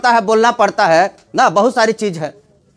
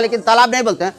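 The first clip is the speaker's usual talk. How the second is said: ordinary talk, nothing marked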